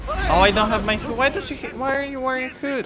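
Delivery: panicked shouts at attackers; panicking gamer. Real, not acted. Attacked in game, yelling.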